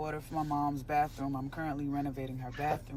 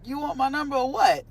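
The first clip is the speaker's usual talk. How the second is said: sassy comeback; 10 semitones higher, 9 dB louder than usual. Retold or imitated. Her retold comeback, then a laugh.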